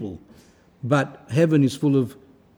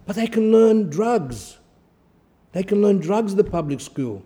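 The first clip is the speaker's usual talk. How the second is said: mock excuse; 6 semitones higher, 4 dB louder than usual. Mocking parents' excuse, preached.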